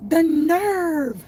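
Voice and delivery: high, loud mock outrage; scandalized exclamation, hammed up; outraged. Reacting to the rude comment, loud high mock indignation. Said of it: "and I was like".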